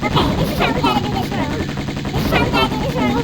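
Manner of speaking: fast, very high sped-up voice; squeaky cartoon chipmunk filter. Real, not acted. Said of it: "y'all really like the chipmunk voice".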